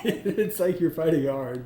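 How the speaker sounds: laughing loudly through the words. Real, not acted. Hypnotist laughing at the subject's struggle, loud laughter. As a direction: laugh it out, amused.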